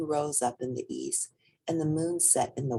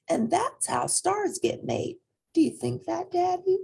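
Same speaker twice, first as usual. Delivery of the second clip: little rabbit, dreamy. A narrator's reading. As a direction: savor the idea like a child.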